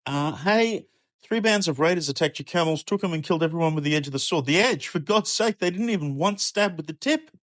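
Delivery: high, rushed, fast and frantic; babbling in outraged panic; frantic messenger. Acted. Third messenger racing through the camel raid news.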